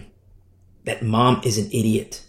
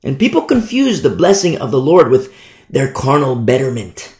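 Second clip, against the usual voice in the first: usual speaking voice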